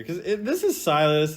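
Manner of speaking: ordinary talk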